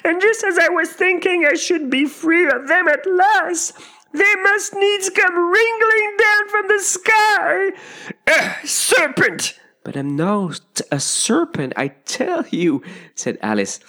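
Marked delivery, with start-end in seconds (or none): 0.0-9.6 very high, loud pigeon shrieking. A narrator's reading. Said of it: "raising its voice to a shriek". 9.8-12.8 put-on slow, high, emphatic girl; exasperated, pitched-up denial; insistent protest. A narrator's reading. Alice protests the accusation.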